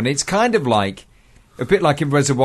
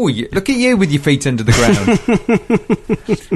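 slow, high, sing-song teasing; teasing mock; 5 semitones higher, slower than usual. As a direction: tease him slowly and playfully. Teasing friend over his mixed-up idiom.

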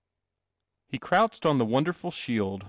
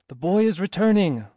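raised, high distant-herald call; cried out; 8 semitones higher, 4 dB louder than usual. A narrator's reading. Voice passing news to other voices, cried out. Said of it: "cried a voice to other voices".

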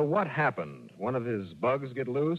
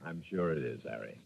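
low, softer deadpan; dry sarcasm; 11 semitones lower, 8 dB quieter than usual. Acted. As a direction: let it drop, dry and unimpressed. Johnny dryly humoring Harry.

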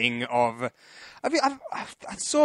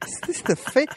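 high-pitched, halting questions; mock confusion in a raised voice; puzzled onlookers imitated; 11 semitones higher than usual. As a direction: wonder aloud who this person is. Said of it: "They're like".

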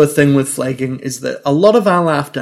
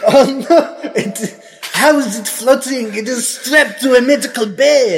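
high, laughing French detective voice; French accent; 7 semitones higher than usual. Acted. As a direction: play a shocked French inspector. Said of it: "we also have to do French accents".

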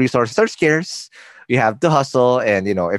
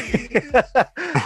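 laugh; 12 semitones higher than usual. Real, not acted. Host laughs at own joke.

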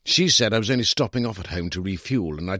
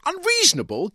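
high, outraged disbelief; incredulous echo; 4 dB louder than usual. A narrator's reading. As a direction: toss the word back, scandalised. Narrator incredulous at wife's word.

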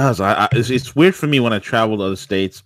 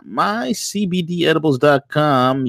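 punchy ad plug; 6 semitones higher, slower than usual. Acted. Repeats sponsor name.

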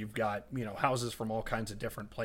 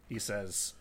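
ordinary talk, nothing marked

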